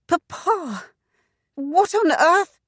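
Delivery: high, startled cry; shocked by her father's state. Acted. Daughter sees father's state.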